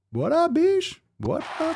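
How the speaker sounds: high squeaky hype voice; squeaky greeting. Acted. Hyped squeaky greeting to chat.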